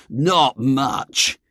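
high, drawn-out "not much"; scornful refusal. A narrator's reading. Check-cap boy turning down games, scornful. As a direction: refuse with contempt in a high boy's voice. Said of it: "said the boy in the check cap with infinite scorn".

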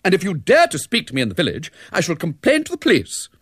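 high, quick, haughty threat; haughty, relishing it. A narrator's reading. Ethel interrupts the Major on the telephone, haughty and relishing it. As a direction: cut in grandly, relishing the threat. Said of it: "now thoroughly enjoying herself, interrupted".

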